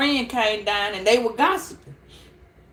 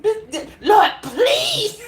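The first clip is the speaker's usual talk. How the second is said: high laughing Lord please; 8 semitones higher, 4 dB louder, slower than usual. Retold or imitated. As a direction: squeal the plea through laughter. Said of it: "she had a master grip".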